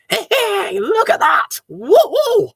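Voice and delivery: high, loud woo-hoo; cheering. Acted. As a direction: cheer as the lights come on. Tree lights switched on.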